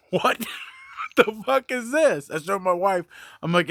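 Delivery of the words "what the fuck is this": higher, slow, laughing what-the-hell; laughing shock at an abusive message; stunned disbelief. Retold or imitated. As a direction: react like you can't believe what you just read.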